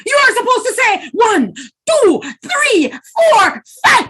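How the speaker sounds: high, loud exasperated counting; furious spider shouting the count; angry shout. A narrator's reading. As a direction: yell the numbers at her. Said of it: "Anansi shouted".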